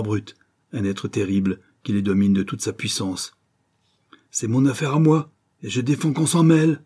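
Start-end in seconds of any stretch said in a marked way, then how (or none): 4.3-6.8 angry order. A narrator's reading. Martin orders the gangs away.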